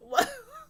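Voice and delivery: high, sudden burst of laughter. Real, not acted. Laughing at the show she is recapping.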